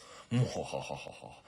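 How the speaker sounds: slow villainous "mwa-ha-ha"; evil laugh. Acted. Assassin imagining skull and fire, hammed-up evil laugh. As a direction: laugh grandly like a taker of souls.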